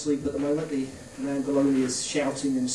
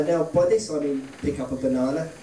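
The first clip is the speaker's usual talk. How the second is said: ordinary delivery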